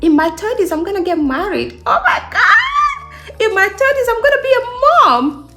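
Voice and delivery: high-pitched gushing excitement; giddy, high-voiced mock disbelief at your own good future; thrilled anticipation. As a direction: squeal it like wonderful news, hammed up. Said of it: "Think about it like".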